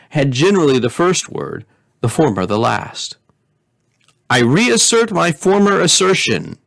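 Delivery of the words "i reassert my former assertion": plunging in. Acted. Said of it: "began Kopikek with a plunge".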